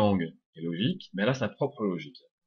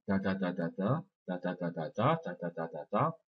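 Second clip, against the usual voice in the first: "ta ta ta ta ta" as equal, evenly spaced beats; same even rhythm; 5 dB quieter than usual. Shown as a demonstration. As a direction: tap out "ta ta ta ta ta" with no accent. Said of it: "c'est le même rythme".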